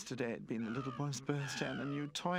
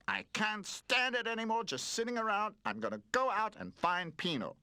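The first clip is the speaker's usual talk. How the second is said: fed up; 12 semitones higher, 5 dB louder than usual. A narrator's reading. Roller fed up, resolute.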